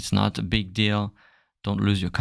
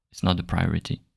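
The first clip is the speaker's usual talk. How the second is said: usual speaking voice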